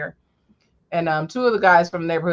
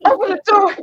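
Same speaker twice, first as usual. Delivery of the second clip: put-on high, loud child's call to mom; mock child's cry; 11 semitones higher, 7 dB louder than usual. Child dragged by car.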